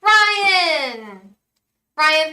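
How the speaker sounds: loud, high call of the name. Real, not acted. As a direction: call Ryan out like across a room. Host calls out next donor.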